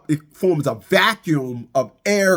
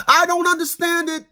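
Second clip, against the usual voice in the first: high squeaky I don't understand it; exaggerated high whine; mock disbelief; 12 semitones higher than usual. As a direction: pitch it way up in disbelief. Repeating line in varied voices.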